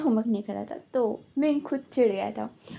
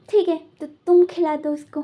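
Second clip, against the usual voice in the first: irritated; 5 semitones higher, 7 dB louder than usual. Retold or imitated. Irritated handing over the baby.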